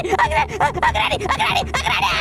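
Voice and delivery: mock-panicked cries; desperate high calling. Gamer calling after Granny in high mock panic. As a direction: call her back in a high wail.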